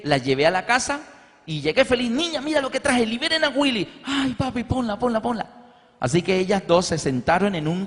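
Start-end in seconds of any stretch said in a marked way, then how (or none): 2.1-4.0 bright and eager, much higher; bursting in with a treat; excited dad. Retold or imitated. Father showing his daughters the new film, excited. 4.0-5.4 put-on child voice, much higher and pleading; little girl begging. Daughters begging their father to play it, childlike pleading.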